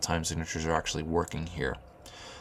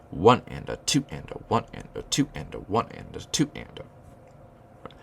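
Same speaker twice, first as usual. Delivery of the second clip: ONE and a, TWO and a, each downbeat hit harder; counting chant fading out toward the end; emphasis on downbeats. Shown as a demonstration. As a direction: punch every downbeat as you count. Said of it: "each downbeat gets an emphasis".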